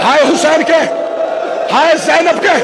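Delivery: impassioned majlis sermon, half-shouted and rising; fervent lament sermon, rising toward a cry; preaching at full force. Acted. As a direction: preach it out to the mourners, pushing each line harder. Sermon climax continues shouting.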